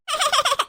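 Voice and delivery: high, quick burst of giggling; child's giggle. Acted. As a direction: giggle in delight at a promised gift. Child cheered up by promised bag. (സന്തോഷത്തോടെയുള്ള കുട്ടിയുടെ ചിരി)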